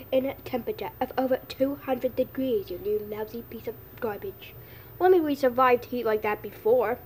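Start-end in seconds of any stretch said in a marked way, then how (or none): 2.7-4.5 hissed under the breath; whispered insult. Acted. Muttered insult aside.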